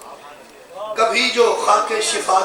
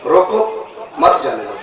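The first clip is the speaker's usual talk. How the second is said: रोको alone, then मत जाने दो dropped low; pause after रोको; 7 semitones lower than usual. Shown as a demonstration. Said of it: "लहजे का कितना फर्क है".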